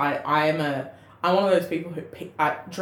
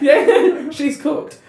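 laughing; 9 semitones higher, 8 dB louder, much slower than usual. Real, not acted. Laughing at friend's joke.

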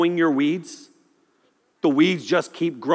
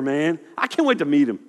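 normal talking voice

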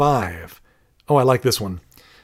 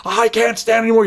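sudden high exasperated cry; mock outburst; 9 semitones higher, 4 dB louder than usual. Parent snapping at the kids, high exasperated outburst. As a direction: play the parent at breaking point.

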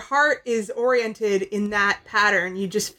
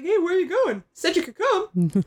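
cheery Scorpia voice; 7 semitones higher than usual. Retold or imitated. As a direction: sing out a hopeful invitation. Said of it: "She's like".

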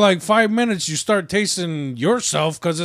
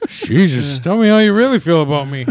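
usual speaking voice

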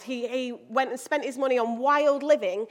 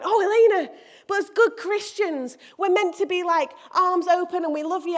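higher, louder mock protest; objecting churchgoer; 5 semitones higher, 5 dB louder than usual. Churchgoer objecting to the preacher, mock reproachful higher voice. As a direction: imitate a well-meaning listener chiding the preacher. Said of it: "Some of you might be going".

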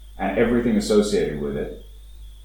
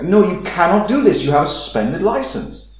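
high, louder mock German outrage; shocked German; 9 semitones higher, 4 dB louder than usual. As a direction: play the rule-bound German aghast at the idea. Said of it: "was absolutely shocked".

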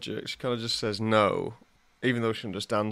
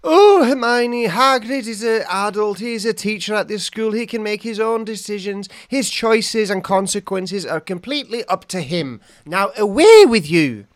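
McGonagall; 9 semitones higher, 11 dB louder than usual. Acted. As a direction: send the pupil away in a high, ringing teacher's voice. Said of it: "she'd go like this".

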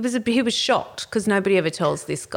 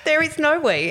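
half-laughing, higher defiant line; laughing retort; 9 semitones higher, 3 dB louder than usual. Retold or imitated. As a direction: laugh through the defiant put-down. Re-acting her retort, laughing.

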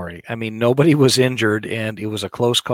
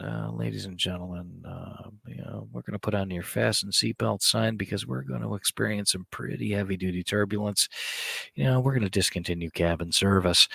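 pilot cockpit headset; 9 dB quieter than usual. Retold or imitated. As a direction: murmur it like a calm pilot. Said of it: "the guy that sits there in the captain going".

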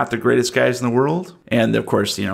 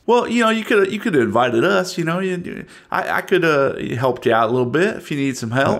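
Southern yokel; 5 semitones higher than usual. Acted. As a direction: fake helpful small-town Southern. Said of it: "I'm from the South".

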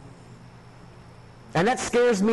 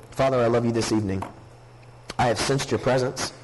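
lower, measured pleading prayer to God; earnest prayer; 8 semitones lower than usual. Acted. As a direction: pray it sincerely, unhurried. Pastor prays before the message.

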